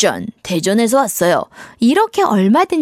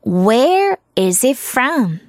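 very slow, word-by-word "Where is it from"; slow model reading; much slower than usual. Shown as a demonstration. Teacher shows how to ask someone's origin, slowly. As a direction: stretch out each word for learners.

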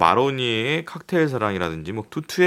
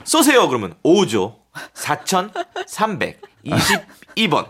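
lottery TV announcer; 8 semitones higher, slower than usual. Retold or imitated. Imitating TV lottery broadcast.

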